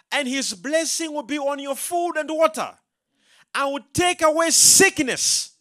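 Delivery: high, loud scripture proclamation; full-force preaching. Real, not acted. Preacher reading God's promise to congregation, full-force preaching. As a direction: proclaim the promise, voice pitched right up.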